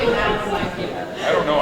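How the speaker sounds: high laughing, then slow, high. Real, not acted. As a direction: giggle it out. Laughs after gullibility joke.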